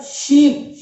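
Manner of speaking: loud ee. Shown as a demonstration. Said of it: "ई की जो ध्वनि है वो थोड़ी सी तेज है".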